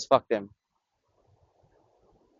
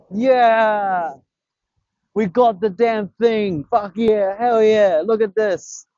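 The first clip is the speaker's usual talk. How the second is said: high, elated whooping celebration; triumphant cheer; 5 semitones higher, much slower than usual. Real, not acted. Angler showing off his catch, triumphant. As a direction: cheer the catch, stretching the words.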